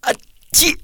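lautes, sehr hohes Niesen. Acted. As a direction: ein kräftiges Atschi heraus niesen. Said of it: "nieste Nick wieder". (Schneemann Nick niest hoch)

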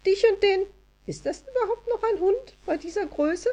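high-pitched little elf, puzzled aside; chattering high elf voice; Elida. A narrator's reading. Wood elf eyes Anja's huge dog, high elf voice. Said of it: "fuhr Elida eilig fort".

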